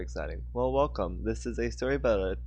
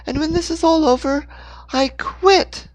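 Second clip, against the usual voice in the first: much higher, loud shriek; 9 dB louder than usual. Acted. Hannah shrieks at Dixon amid explosions. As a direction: scream it in panic.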